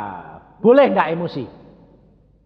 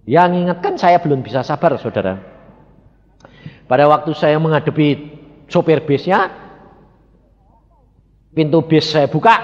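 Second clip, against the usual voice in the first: usual speaking voice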